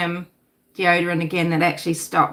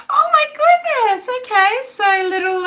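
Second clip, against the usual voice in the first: amused surprise; 4 dB louder than usual. Real, not acted. Reading a funny chat comment.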